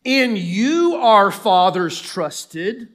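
loud, slow, drawn-out sarcasm; sarcastic. Acted. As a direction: stretch the words out in bitter reproach. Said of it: "you can almost feel the sarcasm".

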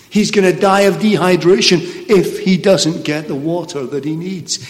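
normal talking voice

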